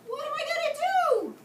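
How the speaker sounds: high, loud crying wail. Acted. Katie wails to her son, high and loud crying. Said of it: "cried harder than ever".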